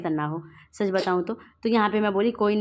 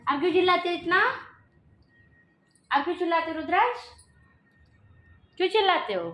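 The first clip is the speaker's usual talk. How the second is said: put-on much higher baby talk, teasing reproach; playful mock scolding; 9 semitones higher than usual. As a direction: chide the little one in fun. Mother playfully scolds shouting baby. (माँ बच्चे से ऊँची तोतली आवाज़ में पूछती है कि वह क्यों चिल्लाता है)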